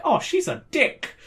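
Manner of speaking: high, put-on witch voice; hag voice. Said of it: "she could be like".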